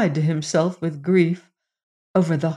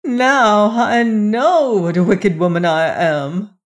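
loud, raised lament; crying out; 4 semitones higher, 5 dB louder than usual. A narrator's reading. Queen learns her stepson suffered for days, crying out. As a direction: wail it in self-reproach. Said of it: "cried the queen".

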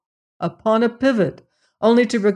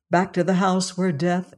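ordinary delivery

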